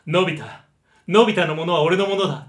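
loud but round and settled; the same bossy line, friendly and full; Gian with a good voice. Shown as a demonstration. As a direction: say it full-voiced so he sounds like a nice guy. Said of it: "なんかいいやつな感じしませんか".

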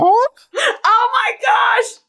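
stretched high laughing squeal of embarrassment; laughing shriek. Real, not acted. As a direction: shriek it out slowly, caught and laughing. Laughing at being caught out.

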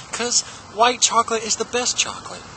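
ordinary talk

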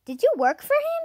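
lightly voiced eager girl; Jessie asking. A narrator's reading. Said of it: "asked Jessie".

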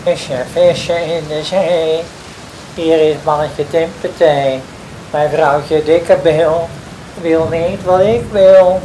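high, forceful summons to the fish; calling out. A narrator's reading. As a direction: call across the sea, pitch climbing. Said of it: "liep naar zee en riep".

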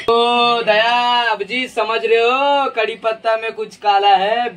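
very slow, drawn-out inspector voice; hammed-up suspicious drawl; TV detective parody. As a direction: stretch each name like a melodramatic police boss. Parody detective addressing Daya, Abhijeet.

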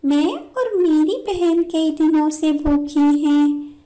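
गिड़गिड़ाती भूखी चिड़िया. Acted. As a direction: plead with uncle, high and insistent. (भूखी चिड़िया की ऊँची गुहार)

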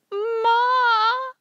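long, high, loud wail for mother. A narrator's reading. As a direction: wail it out like a distraught child. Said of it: "I wailed".

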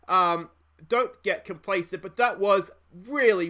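ordinary delivery

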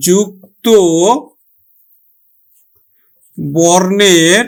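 slow, spaced dictation with long pauses; teacher dictating the rule slowly. Shown as a demonstration. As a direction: read it out slowly for students to write down. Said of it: "dictation pace for notes".

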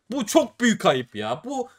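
high, drawn-out whine of protest; whiny wail. Real, not acted. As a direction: stretch the words into a long, high complaint. Whining over a bad case drop.

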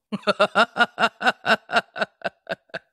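a long laugh that falls away; laughing at her own punchline; real laugh. Real, not acted.

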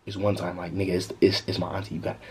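low, very soft urgent aside; hushed warning. Retold or imitated. Warning the girl his auntie is coming, hushed warning. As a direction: drop to an under-the-breath warning.

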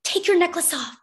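high, urgent command; urgent, voiced stage whisper. A narrator's reading. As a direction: press her to act now. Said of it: "she whispered urgently".

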